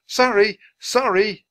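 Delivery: high, loud repeated apology; shouting. A narrator's reading. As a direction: keep calling apologies to everyone around. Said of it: "Jack kept shouting".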